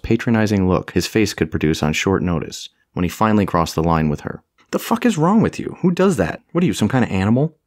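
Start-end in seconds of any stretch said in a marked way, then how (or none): 4.7-7.6 outraged. Acted. Joe's outraged patronizing outburst.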